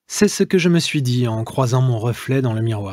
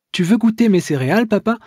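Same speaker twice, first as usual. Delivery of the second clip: put-on little girl voice; 8 semitones higher, faster than usual. Five-year-old daughter offers cereal.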